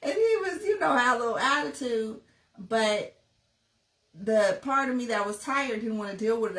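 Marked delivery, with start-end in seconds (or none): 0.0-2.2 laughing through tears. Real, not acted. Laughing-crying while recalling lockout.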